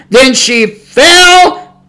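slow weighty preaching cadence; solemn scripture reading. Acted. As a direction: read it like the final blow. Preacher reads scripture climax slowly.

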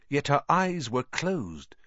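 ordinary delivery, nothing marked

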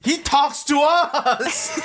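high, very drawn-out laughing protest; mock exasperation. Real, not acted. Co-host explains that only viewers hear Remy, mock exasperated. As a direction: stretch each word in laughing disbelief. Playful argument about Remy talking.

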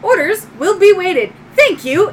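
high, loud robot acknowledgement; little droid. Acted. Droid confirming it awaits orders, high robotic voice. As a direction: confirm politely as an obedient robot. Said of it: "your little droid".

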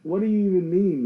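high, loud disbelief; shocked what-the-fuck at a silly ad; incredulous outburst. Real, not acted. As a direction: squeal it out in total disbelief. Mock outrage at the ad.

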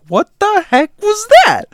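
very high, loud shriek of alarm; acted high-pitched panicked yell; retold freaked-out outburst. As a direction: shriek it in disbelief. Retold freaked-out reaction to friend.

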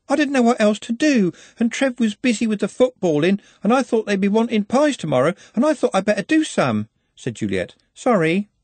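put-on nervous young Juliet rushing her excuses; anxious young girl. A narrator's reading. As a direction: babble it out like a flustered young girl. Juliet voiced in story.

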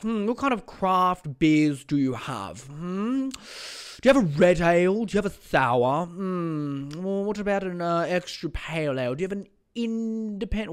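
snobby craft beer customer. Retold or imitated. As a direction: ham up a pretentious beer snob. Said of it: "they'd ask for, like".

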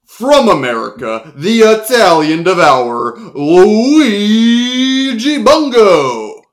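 stretched, booming name call; announcer. Acted. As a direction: draw out the name. Announcer introduces Luigi.

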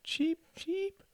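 tiny, soft falsetto echo of a squeak; echoed bird cheep. A narrator's reading. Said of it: "echoed their mother".

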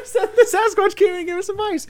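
high, loud words through laughter; cracking up over the Sasquatch joke; laughing. Real, not acted. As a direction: laugh while you get the line out.

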